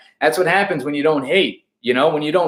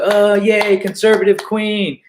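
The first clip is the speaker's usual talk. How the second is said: mock cheer; 7 semitones higher, 4 dB louder, slower than usual. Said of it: "People are like".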